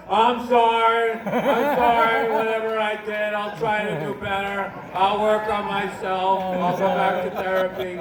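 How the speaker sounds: loud, drawn-out fake contrition; hammed-up, slow, placating plea; mock apology. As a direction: stretch out each apology at full volume. Acted placating apology to the wife.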